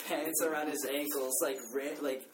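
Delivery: usual speaking voice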